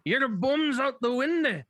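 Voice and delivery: put-on Scottish accent. Shown as a demonstration. Hunter auditioning for a Scottish part, Scottish accent. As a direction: lay on a Scottish accent to show it off. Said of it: "Can you do a Scottish accent?".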